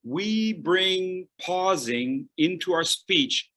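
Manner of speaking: slow, deliberate restatement; slow repeat. Shown as a demonstration. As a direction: say it again, slowly. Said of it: "Let me say that again".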